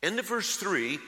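ordinary delivery

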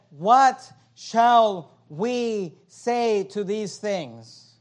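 very slow, drawn-out question falling away; dramatic scripture reading. Acted. Pastor reading Paul's question to the church, drawn out. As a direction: read it like a solemn question.

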